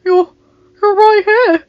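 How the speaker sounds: high, loud, pleading protest; desperate. A narrator's reading. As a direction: plead with her, voice high and strained. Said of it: "he said desperately".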